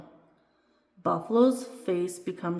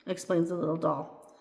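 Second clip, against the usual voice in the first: ordinary delivery, nothing marked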